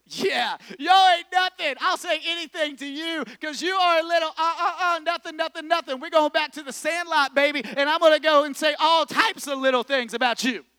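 put-on high, loud taunting kid behind a big protector; taunting bullies. Kid taunting bullies from behind his strong dad, loud and high. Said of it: "I'm sitting there and I'm going".

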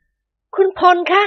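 very high, drawn-out plea; trembling wife. A narrator's reading. Nuanla-o appeals to Phon for truth, trembling voice. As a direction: call his name high and anxiously. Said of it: "นวลละออพูดเสียงสั่นๆ".

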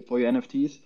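ordinary talk, nothing marked

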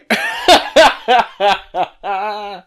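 a short cracked laugh through a sore throat; strained laugh. Real, not acted. Vlogger laughs at his own failing voice, strained laugh. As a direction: laugh it off though it hurts.

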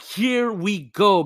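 drawn-out, high, loud hyped exclamation; hyped reaction. Retold or imitated. Grandchild finally getting the cat story, hyped.

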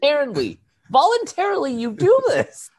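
very high, incredulous outburst; exasperated disbelief. Retold or imitated. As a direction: hammer it home in mock outrage. Acted indignant retort about tracking devices.

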